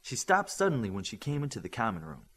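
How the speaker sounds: plain reading voice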